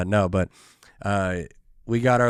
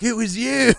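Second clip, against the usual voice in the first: put-on high, loud drunken accusation; drunk guy accusing; 12 semitones higher, 5 dB louder than usual. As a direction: screech it like a sloppy drunk who finally recognises you. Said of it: "he was like".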